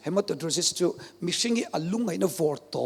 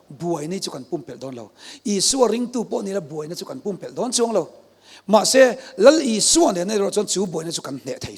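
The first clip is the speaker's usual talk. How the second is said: louder, pressing preaching; rising preaching; 4 semitones higher, 6 dB louder than usual. Real, not acted. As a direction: drive home victory over trouble. Preaching swells again. (thahnem ngai taka thu sawi)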